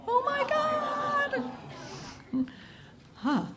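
high squeal of delight; mock gasp. Real, not acted. Host seeing the baby photo, squealing.

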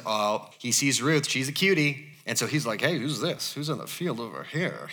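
low, soft would-be smooth talker; quiet, hammed-up admiring murmur; lightly mocked flirtation. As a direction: play a mild parody of an interested suitor. Mimicking a romantic reading of Boaz noticing Ruth.

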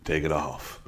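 imitating a seductive soul intro. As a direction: croon it low like a soul singer. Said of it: "the intro is literally like".

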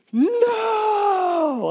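very high drawn-out cry of horror; despairing high no; anguished cry. Retold or imitated. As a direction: howl it as the vase shatters. Vase explodes in office.

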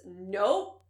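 normal talking voice

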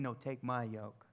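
soft, quiet, tender promise; quiet voicing of Jesus, reassuring. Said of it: "He's saying".

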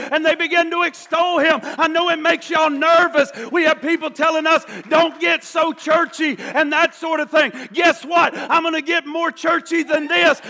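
loud, fervent pulpit delivery; impassioned preacher in full cry; full-force preaching. Real, not acted. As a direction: drive it home to the congregation.

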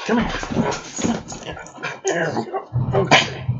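high, soft pet-talk voice. Real, not acted. Settling the pet down.

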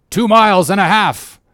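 high, loud reply down to deck; lookout's shouted answer. Acted. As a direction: call the distance down.

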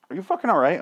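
high-pitched, exasperated question to the dog; exasperated check. Real, not acted. Calling out to his dog.

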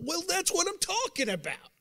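high, loud preacher's cry; preaching shout. Real, not acted. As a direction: lift it up and call it out to the room.

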